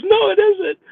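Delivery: very high, whiny retort; mock tearful protest. Crying passenger contradicting her driver, mock tearful whine. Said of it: "she is boo-hooing back there".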